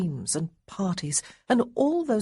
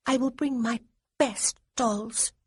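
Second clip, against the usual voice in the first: lightly voiced girl, very serious; a child being utterly solemn; grave little girl; 6 semitones higher than usual. A narrator's reading. Little girl promising her dolls, gravely serious. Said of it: "she said with complete gravity".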